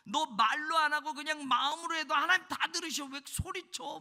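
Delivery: high, loud mimicked complaint; mock indignant objection. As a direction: act out someone scolding the noisy one. Acting out an objector to shouting.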